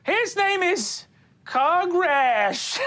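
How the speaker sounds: high slow naming of the dog; Wally's high voice. Acted. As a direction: say the name slowly in Wally's high voice. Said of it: "he goes".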